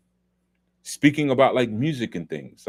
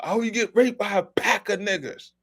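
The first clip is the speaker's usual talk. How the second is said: put-on pitched way up in a clumsy Australian accent; bad Australian accent; 13 semitones higher than usual. Vlogger imitating Mel Gibson's rant in Australian accent. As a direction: attempt the Australian accent and miss. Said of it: "I can't even do the Australian accent".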